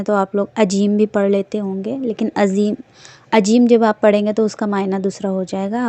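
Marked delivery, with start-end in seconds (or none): none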